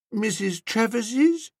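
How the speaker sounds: high, slow questioning; perplexed dreamy woman. A narrator's reading. Woman guessing whose heart aches, perplexed. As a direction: guess slowly, high and puzzled.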